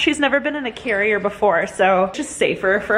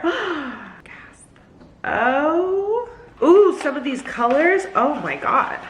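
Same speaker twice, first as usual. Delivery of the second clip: excited; 7 semitones higher, much slower than usual. Real, not acted. Unboxing makeup.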